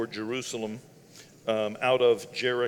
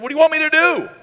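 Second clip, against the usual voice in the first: high, loud shouted question; an ordinary guy calling out loudly; hollering over; 13 dB louder, faster than usual. Acted. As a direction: yell it across a distance. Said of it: "he just hollered over".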